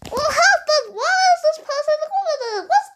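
high, laughing disbelief; shocked. Pretend. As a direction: gasp it out in shock. Shocked host reacts.

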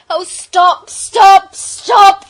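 very loud, high screaming plea; desperate cry. A narrator's reading. Bobby begging the oncoming engine to halt, desperate scream. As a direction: scream it, building in force. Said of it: "cried Bobby".

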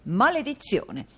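a vain fop fuming theatrically; foppish Mantalini. Acted. Dandy Mantalini cursing at the door bell, exasperated.

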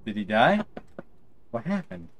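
startled gamer reaction; surprised. Real, not acted. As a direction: react in shock to the death. Surprised by game event.

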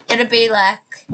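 usual speaking voice

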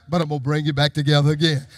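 low, fast aside in preaching; low quoted promise. Acted. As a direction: drop low and say it quickly. Quoted prophecy, then repeated loudly.

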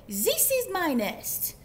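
put-on high, loud, slow French-accented protest; storybook duck with French accent. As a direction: claim it indignantly in a hammy French accent. Duck fights for the nest.

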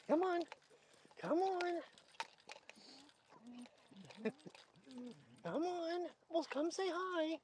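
high, soft coaxing call; soft high-pitched come-here call; coaxing the dog. Real, not acted. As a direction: gently lure the shy dog over.

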